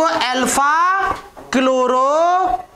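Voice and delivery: syllables stretched right out; slow dictation. Real, not acted. Teacher names the compound for students, slow dictation. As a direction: draw the name out as you write.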